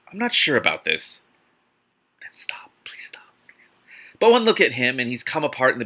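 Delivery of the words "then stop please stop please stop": soft unvoiced begging; pleading whisper. Real, not acted. Reader begging the story to stop in a whisper.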